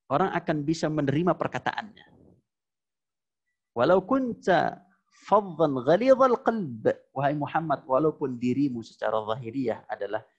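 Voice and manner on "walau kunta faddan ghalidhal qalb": Quranic recitation. Acted.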